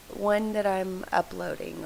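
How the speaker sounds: usual speaking voice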